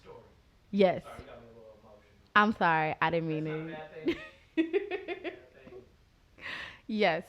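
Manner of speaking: ordinary talk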